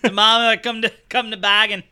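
mock call in broad Boston; Doctor Strange with a thick Boston accent. Boston Doctor Strange yelling at Dormammu, broad Boston accent. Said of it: "Yelling at Dormammu".

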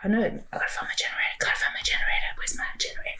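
fast whispered muttering; hushed self-talk, rushed; whispering to herself. Real, not acted.

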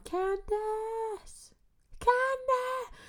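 high, drawn-out motherly call; mom calling out. Retold or imitated. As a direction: imitate a mother calling her child from afar. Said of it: "I would hear my mom".

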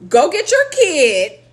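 exasperated. Retold or imitated. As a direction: spell it out to a slow caller. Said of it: "And I was like".

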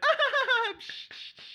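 a high laughing "ah" and then breathy psh claps; mock sitcom clapping. Imitating sitcom audience applause for Uncle Andy. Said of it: "That's how I imagine people clap".

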